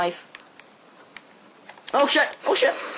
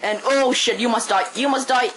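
usual speaking voice

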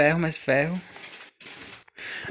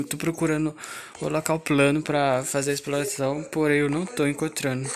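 ordinary talk

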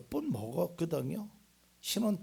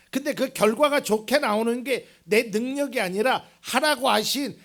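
loud, raised preaching voice; 8 semitones higher, 12 dB louder than usual. Real, not acted. Preacher tells congregation about God and ability, preaching loudly. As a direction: drive each phrase home at full force.

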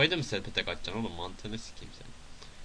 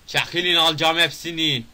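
loud, higher-pitched yell; playful shout; 5 semitones higher, 7 dB louder than usual. Pretend. Player grabbing everything in the game, playful shout. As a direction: call out to clear the way. Yelling at game characters while playing.

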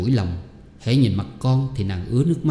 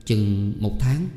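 pensive reply; much slower than usual. Acted. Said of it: "trầm ngâm một lát rồi đáp".

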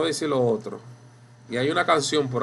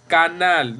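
canal said as ca-NAL, louder; correct final stress; 4 semitones higher, 6 dB louder than usual. Shown as a demonstration. Teacher models the example word, canal stressed on NAL. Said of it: "decimos canal".

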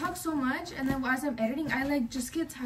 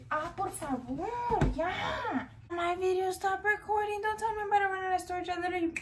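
higher, fast, panicked disbelief; alarmed disbelief; 7 semitones higher than usual. Real, not acted. Realizing her phone stopped recording, high fast alarmed disbelief. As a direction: rush out the dismay that the video stopped.

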